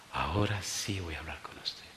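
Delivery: low, hushed, heavy-hearted quote; retold tearful voice. Sick young man finally opens up to the pastor, tearful. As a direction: imitate a weeping young man, very softly. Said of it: "llorando me dice".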